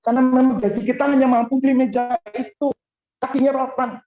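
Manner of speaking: high, loud pulpit voice; forceful preaching. Acted. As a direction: preach it out to the congregation. Testimony preached at full force.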